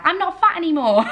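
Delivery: playful mock scream of relief. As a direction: blurt it out like a happy half-scream. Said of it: "kinda wanted to scream out".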